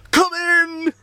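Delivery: high, held sing-out to a visitor; high-pitched welcoming call. Acted. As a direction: call towards the door. Calling to knocker at door.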